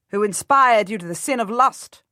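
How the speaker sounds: ordinary delivery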